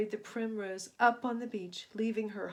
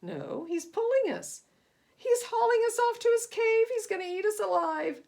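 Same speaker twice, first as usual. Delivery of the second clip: higher, louder frightened wail; panicked cry; 11 semitones higher, 8 dB louder than usual. Acted. Passengers panic.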